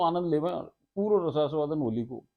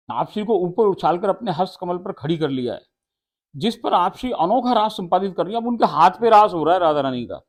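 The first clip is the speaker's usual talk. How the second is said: raised, loud, solemn telling of the raas; devotional recitation; 2 semitones higher, 7 dB louder than usual. Acted. Preacher reciting the raas scene to devotees, loud and solemn. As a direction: recite the holy scene to the listeners.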